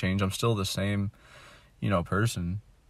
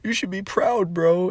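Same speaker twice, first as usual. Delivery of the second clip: high, mocking "proud of you" voice; mock encouraging voice; 9 semitones higher, 3 dB louder, slower than usual. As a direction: parrot the hollow praise. Mocking people's praise.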